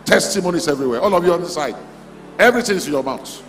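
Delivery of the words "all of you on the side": normal talking voice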